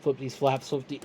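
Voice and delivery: trying FLIP THESE FLAPS quickly, then stumbling; tongue-twister attempt. Shown as a demonstration. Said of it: "Try saying that five times fast".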